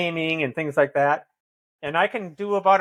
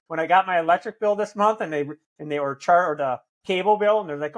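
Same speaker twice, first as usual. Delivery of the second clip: ordinary talk, nothing marked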